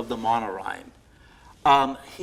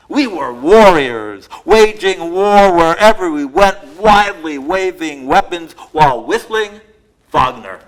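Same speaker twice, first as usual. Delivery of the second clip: bold, punchy alliterative recital, louder; poem reading; 4 semitones higher, 10 dB louder, slower than usual. Acted. Poet reciting her Worcester poem to the audience, emphatic and loud. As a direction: hammer out every W like a performance poet.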